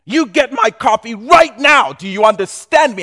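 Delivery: loud, high, self-important VP barking orders; loud bossy executive shouting for coffee; arrogant boss. Acted. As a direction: lord it over the assistant, demanding. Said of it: "bossy VP to the admin".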